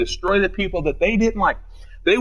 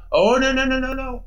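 mocking no no; 8 semitones higher than usual. Mocking Christians who avoid offending.

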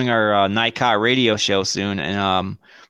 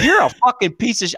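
high-pitched imitation of her yelling, laugh breaking in; mock yelling ex; 13 semitones higher, 3 dB louder than usual. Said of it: "while she was yelling".

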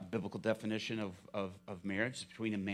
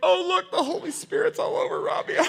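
high, loud, laughing mock awe; imitating congregants; 13 dB louder than usual. Pastor imitating congregants who mistake his nerves, high mock awe. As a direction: gush like impressed churchgoers.

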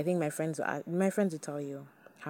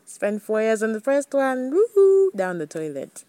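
loud, high, ironic woohoo over a failed relationship; mock celebration; 6 semitones higher, 12 dB louder than usual. Real, not acted. To listeners, jokingly cheering her four wasted years.

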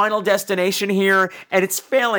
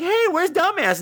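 higher-pitched cheery call; mock clueless friends; 9 semitones higher than usual. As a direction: chirp it like an oblivious pal. Mocking characters asking after her.

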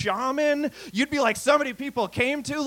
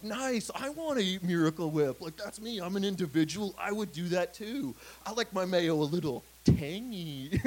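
softer, dopey satisfied consumer voice; mock gullible viewer; 3 semitones lower, 6 dB quieter than usual. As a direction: play the sucker the ad wants. Viewer won over by the ad.

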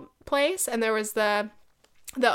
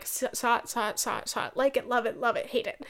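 quick, dismissive mock checklist; mock rushing through; 3 dB quieter than usual. Visitor mimics racing through an art museum, mock rushing. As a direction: rattle off snap verdicts, softly. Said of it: "I don't run through it and be like".

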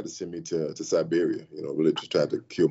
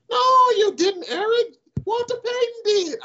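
much higher put-on nasal voice; John Shaw's nasally voice; 5 dB louder than usual. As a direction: mimic the GM's nasal whine, slowly at the end. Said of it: "he has a nasally voice".

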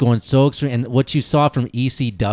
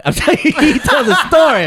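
laughing; 14 semitones higher, 5 dB louder, faster than usual. Real, not acted. Laughing insistence.

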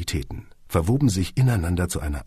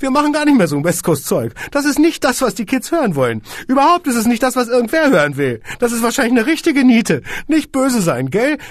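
high, loud rejection; dismissive squeaky boss; 8 dB louder than usual. A narrator's reading. Boss rejects the song to the floored Kunze, high and loud. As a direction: brush them off.

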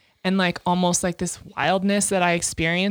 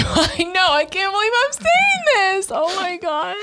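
much higher, laughing through the words; embarrassed laughter; 12 semitones higher than usual. Real, not acted. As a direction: giggle helplessly, embarrassed by what you just said. Embarrassed after a sexual confession.

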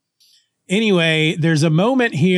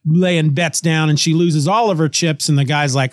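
usual speaking voice

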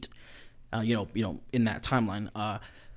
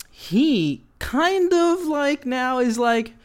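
much higher, slow drawn-out voice; 12 semitones higher, 6 dB louder, much slower than usual. Acted. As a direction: stretch it out way up high. Building to a Chucky quote.

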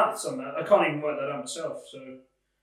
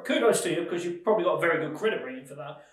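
ordinary talk

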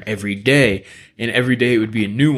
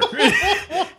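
real, high wheezing laugh; high burst of laughter. Real, not acted. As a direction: crack up at the punchline. Laughing at own punchline.

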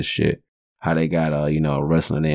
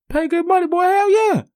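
much higher, loud put-on voice of a proud father; dad's boastful voice; 4 dB louder than usual. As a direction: crow it like a proud dad flexing. Dad insists son stand up.

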